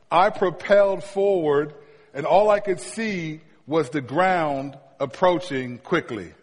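ordinary talk, nothing marked

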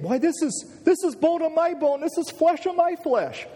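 very high, elated exclamation; beside himself with excitement. Acted. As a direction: burst out in amazed joy. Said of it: "he was just excited and almost beside himself, saying".

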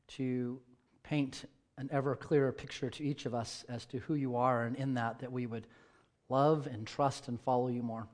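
soft, hushed pastoral prayer. Acted. Pastor praying over the church, hushed and soft. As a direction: pray quietly over the congregation. Pastor prays before sermon.

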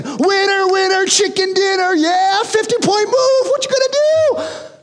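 high, gleeful gloating chant; gloating victory cheer. Retold or imitated. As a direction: crow over the win like a sore winner. Gloating Scrabble victory dance.

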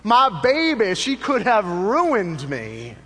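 high, loud preacher's cry with long drawn-out words; E V Hill's great voice. Retold or imitated. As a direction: imitate a great preacher crying out, stretching the key words high and slow. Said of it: "in his great voice".

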